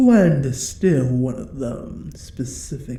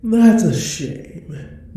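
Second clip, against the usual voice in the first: mock disappointment, slow and loud; a slow, mocking sigh of regret; mock pity; 8 semitones higher, 5 dB louder, slower than usual. Dragon mocking the thief who stayed hidden, mock regret.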